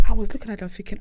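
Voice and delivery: ordinary talk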